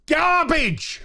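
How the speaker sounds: disgusted verdict. Real, not acted. Vlogger judging the show, scornful high verdict. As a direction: spit out the final word.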